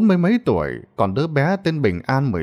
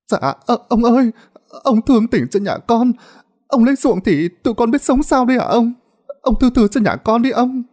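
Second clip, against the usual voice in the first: much higher, frightened begging to the landlord; frightened pleading; 9 semitones higher than usual. A narrator's reading. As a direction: plead high and anxious, on her knees. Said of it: "Bà Hương sợ quá".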